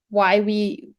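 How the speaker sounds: ordinary talk, nothing marked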